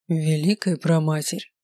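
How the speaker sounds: low, slow, sighing voice; weary sigh. A narrator's reading. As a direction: sigh it out, resigned. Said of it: "вздохнул он".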